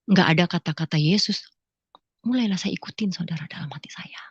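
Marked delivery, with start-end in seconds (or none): 2.3-4.2 hushed whisper. Real, not acted. Telling the congregation her secret thoughts, whispered. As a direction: breathe the words in a whisper. Inner thoughts while listening to song.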